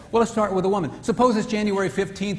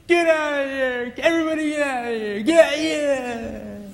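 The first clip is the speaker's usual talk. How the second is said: grumpy angry yell; 9 semitones higher than usual. Retold or imitated. Husband chasing the wife off his air hose, high mock roar. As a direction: bellow everyone out like a grumpy bull. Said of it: "He's grouchy and grumpy and angry".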